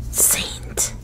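usual speaking voice

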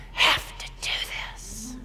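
strained whisper dying away; acted desperate whisper; whispered plea. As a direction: beg in a voiceless, exhausted whisper. Jesus pleading with God, acted.

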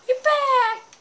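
excited greeting. Real, not acted. Player welcoming a returning friend, thrilled. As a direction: greet them with delight.

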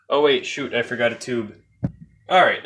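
ordinary talk

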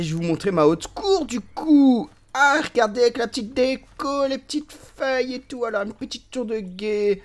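high-pitched, cooing excitement; playful high gushing over cute details; gushing show-off. Pretend. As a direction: show it off in a squeaky little voice. Gushing while showing off his castle.

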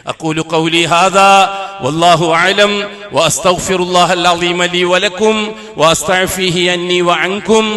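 low, slow, intoned closing prayer; formal Arabic invocation. Acted. Imam closing the sermon with Arabic prayer, slow intoned. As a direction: chant the closing du'a calmly.

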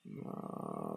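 ordinary talk

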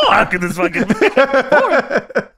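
loud exasperated disbelief, rising to a high cry; mock outrage. Host playing wrestlers baffled by the count, mock outrage.

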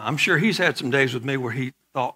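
ordinary delivery

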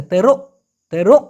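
"তেরো" ending in a swallowed k, like locals; মালয়েশীয় উচ্চারণ. Shown as a demonstration. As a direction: cut "তেরো" short with a catch in the throat. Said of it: "মূলত ওরা বলতেছে". ("তেরো"-র শেষটা গলায় আটকে)

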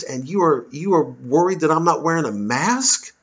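exasperated retort. Retold or imitated. Comedian retells his heated reply to the fan. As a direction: throw it back at him, incredulous and scornful. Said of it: "I said".

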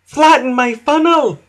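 high, loud exasperated exclamation; Emily. A narrator's reading. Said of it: "steamed Emily".

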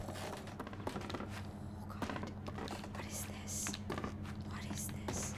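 a hushed, unvoiced whisper; nervous whisper. Real, not acted. Streamer creeped out in the horror game, nervous whisper. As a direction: whisper it, wary of what's ahead.

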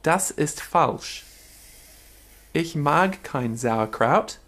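slow, deliberate German-language imitation; mock-blunt German, drawn out slowly; imitating blunt German. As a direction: play a direct German, word by word. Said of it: "how you Germans just get straight to the point".

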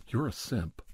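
usual speaking voice

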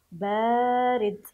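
بارد slow and loud, aa held long; lengthened sound. Shown as a demonstration. Teacher modelling the word for pupils, loud drawn-out بارد. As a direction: call out بارد with the first vowel stretched. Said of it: "تمديد صوت الباء".

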